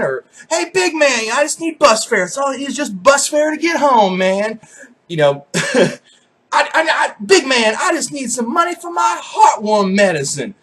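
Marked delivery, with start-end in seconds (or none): 0.5-4.6 higher, half-shouted bus fare plea; pleading for bus fare, pitched up; imitating a homeless man. Panhandler asking the big man for fare, imitated high. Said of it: "every single one of them calls me big man". 5.5-10.5 high, stammering then half-shouted plea; mock beggar angling for money; imitating a homeless man. Panhandler begging for heartworm medicine, imitated high and strained. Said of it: "every single one of them calls me big man".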